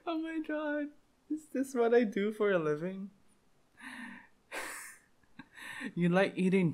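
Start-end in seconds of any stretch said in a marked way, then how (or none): 0.1-3.1 laughing disbelief. Real, not acted. Cracking up at own jokes.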